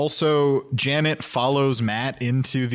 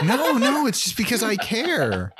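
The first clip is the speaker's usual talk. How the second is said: mock protest; 9 semitones higher, 3 dB louder than usual. Mock protest of caring to friend.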